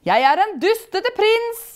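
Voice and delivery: high, loud taunting spirit; mocking. A narrator's reading. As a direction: make fun of the prince loudly. Spirit mocks the prince.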